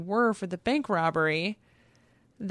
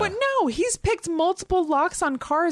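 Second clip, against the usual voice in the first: high, animated protest; indignant disbelief; worked-up objection; 8 semitones higher than usual. Real, not acted. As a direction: blurt it out, incredulous and high. Arguing excitedly against own theory.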